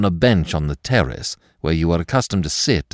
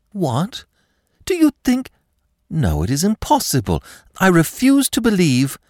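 put-on much higher, alarmed young lady; shocked disbelief; 11 semitones higher than usual. A narrator's reading. Girl learning her enemy attacked her, shocked disbelief. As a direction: recoil in high-pitched disbelief.

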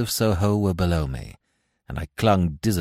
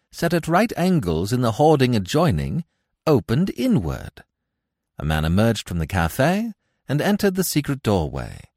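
usual speaking voice

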